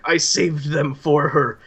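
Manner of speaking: high, loud, frantic raving; manic madman. Acted. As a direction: rave it out like a man gone mad. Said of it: "and he keeps cackling".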